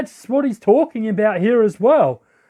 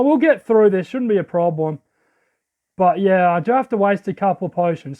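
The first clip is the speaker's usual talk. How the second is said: ordinary talk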